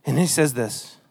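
normal talking voice

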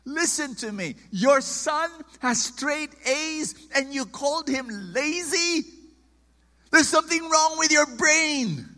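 indignant preacher telling off a parent; imagined telling-off. Retold or imitated. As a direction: scold the father, loud and high. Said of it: "I wanted to tell him".

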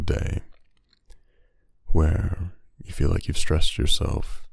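unhurried, hushed reflection, letting each phrase settle; ASMR monologue. Acted. Podcast host easing listeners into rest and sleep, soothing ASMR monologue.

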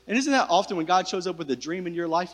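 normal talking voice

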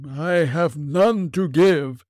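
slow, high mournful ghost; unearthly voice. Acted. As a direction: intone like a chained spirit.

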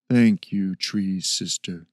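soft, deep, slow creature voice; slow gentle ape-man, low; soft spoken man. Acted. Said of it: "in a soft, deep voice".

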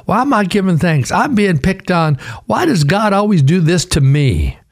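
high-pitched fervent preaching. Acted. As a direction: preach it with rising fire.

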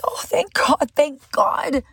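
retold grateful exclamation; relieved. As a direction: let the relief burst out.

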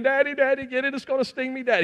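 high-pitched imitation of a frightened little girl; acted-out panic from a daughter; retold panicked screaming. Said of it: "She's screaming".

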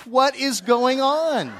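loud, high, drawn-out mock demand; exasperated wife. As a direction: imitate a fed-up wife, stretching every word.

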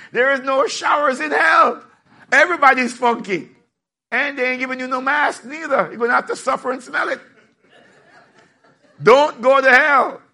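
preacher shouting a warning about hell to the congregation; preaching shout. Real, not acted. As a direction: cry the warning out to the crowd.

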